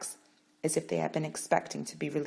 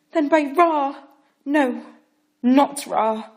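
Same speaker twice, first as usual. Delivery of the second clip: loud, high vow; fierce oath; 10 semitones higher, 14 dB louder, much slower than usual. Acted. Parrot swearing before the court, loud vow. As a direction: swear it boldly.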